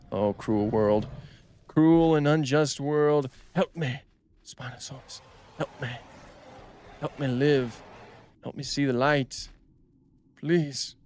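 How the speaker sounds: hammed-up dying plea, slow; mock tragic lament, a touch breathy; dramatic tremolo.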